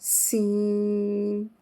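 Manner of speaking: सीन slow with a whistling s; hissing s. Shown as a demonstration. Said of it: "अब इसी sound को".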